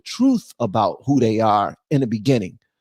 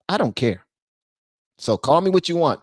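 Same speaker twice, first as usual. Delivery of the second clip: ordinary talk, nothing marked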